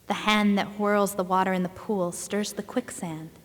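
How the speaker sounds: ordinary talk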